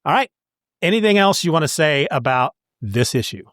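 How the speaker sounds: ordinary talk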